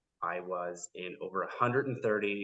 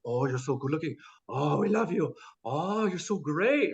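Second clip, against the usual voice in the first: gushing admiration; 6 semitones higher, 4 dB louder than usual. Retold or imitated. Korean crew flattering a foreign actor, gushing. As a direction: imitate fawning fans.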